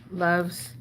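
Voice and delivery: ordinary talk, nothing marked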